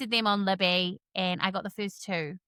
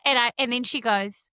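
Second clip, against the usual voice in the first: normal talking voice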